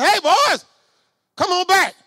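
high, loud call across the street. Retold or imitated. Prophet calling his fled friends back, loud call. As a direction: shout for the friends to come back.

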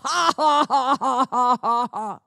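scornful put-on laugh; mocking laughter. Preacher voicing a man laughing at the devil, scornful. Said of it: "I'm laughing at you, devil".

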